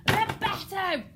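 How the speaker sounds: loud, high angry outburst; angry shout. Shown as a demonstration. King ordering the girl gone, furious shout. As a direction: bang the fist and bellow it. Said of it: "almost banging his fist".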